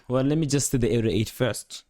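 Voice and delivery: ordinary talk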